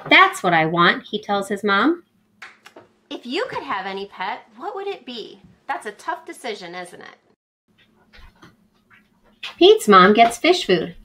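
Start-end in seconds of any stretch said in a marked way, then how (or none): none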